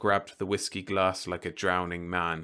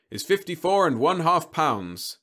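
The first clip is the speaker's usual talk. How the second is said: high, loud sugary pitch; robot salesman voice; 8 semitones higher, 7 dB louder than usual. Acted. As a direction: coax the child like a machine. Robot weighs girl.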